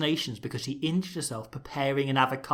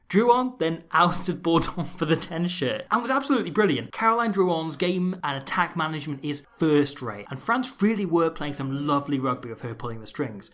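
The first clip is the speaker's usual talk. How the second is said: ordinary talk, nothing marked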